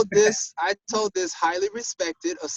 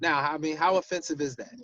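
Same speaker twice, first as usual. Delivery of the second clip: ordinary talk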